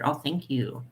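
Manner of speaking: ordinary delivery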